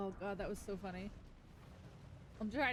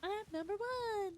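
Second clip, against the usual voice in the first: wolf voice; 13 semitones higher than usual. Pretend. Silly high voice in game.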